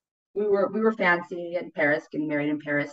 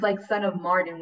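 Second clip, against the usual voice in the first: ordinary talk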